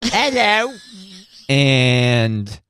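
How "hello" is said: high put-on British "Hello"; playful British accent demo. Shown as a demonstration. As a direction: greet in a posh-ish British accent. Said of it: "me working on my British accent".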